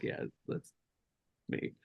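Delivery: father quietly admitting he wrote it; quiet admission. Retold or imitated. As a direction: let it slip almost under the breath. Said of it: "He's like, he's like".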